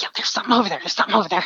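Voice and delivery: hurried whisper, pressing the point; insistent whisper. Retold or imitated. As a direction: whisper it twice, urgently insisting.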